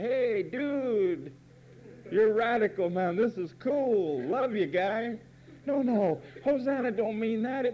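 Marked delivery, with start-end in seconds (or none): 0.0-5.2 light, higher mock surfer-dude voice; a casual hippie praising his buddy, hammed up a little. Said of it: "you thought Hosanna meant".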